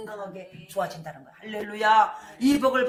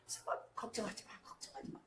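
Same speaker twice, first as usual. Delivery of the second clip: unvoiced hush of 걱정하지 마; whispered reassurance; 2 semitones lower, 17 dB quieter than usual. Inner voice comforting the weeping believer, whispered. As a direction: breathe it softly as comfort. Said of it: "마음에서 이미 됐다고 말하는 거야".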